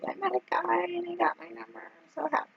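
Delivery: put-on high, bright kid voice gushing; a happy boy bubbling over; excited son. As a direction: squeal it like a thrilled child. Son happily retelling his mother's news.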